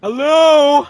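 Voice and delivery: loud high call into the dark. Acted. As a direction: shout hello into emptiness. Calling out in empty street.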